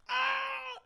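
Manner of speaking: high-pitched wail of pain; imitated pain cry. Imitates injured man's cry.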